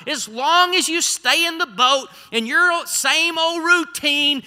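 high, loud preaching building to a climax; impassioned preaching. Acted. Preacher urging the congregation out of the boat, building fervor. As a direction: drive it home, stretching the words.

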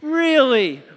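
high, loud drawn-out really; loud exaggerated really. Shown as a demonstration. Preacher leading the crowd in a big surprised really. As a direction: ham up the surprise. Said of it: "Okay, ready?".

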